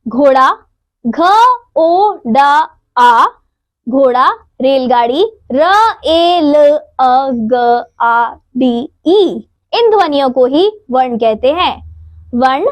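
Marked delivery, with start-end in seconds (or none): 1.1-3.2 घोड़ा broken into घ, ओ, डा, आ, slowly; sounds of घोड़ा split out. Shown as a demonstration. Teacher to children, spelling out घोड़ा sound by sound. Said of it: "अन्य शब्दों में ये ध्वनियां निकलती हैं". 5.5-9.5 रेलगाड़ी broken into र, ए, ल, अ, ग, आ, डी, ई; sounds of रेलगाड़ी split out. Shown as a demonstration. Teacher to children, spelling out रेलगाड़ी sound by sound. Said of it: "अन्य शब्दों में ये ध्वनियां निकलती हैं".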